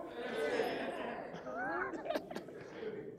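high breathy laugh; real high laugh. Real, not acted. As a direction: laugh at your own joke. Laughs at own joke.

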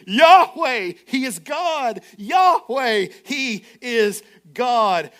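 loud ringing proclamation, last line slowed; proclaiming crowd. Acted. The people to Yahweh, loud and worshipful. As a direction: cry it out on your face before God.

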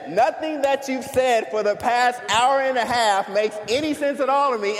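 indignant repeat. Retold or imitated. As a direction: replay the line outraged, as if still shocked. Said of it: "He literally says".

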